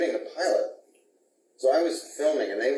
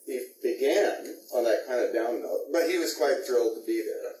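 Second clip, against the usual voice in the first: ordinary delivery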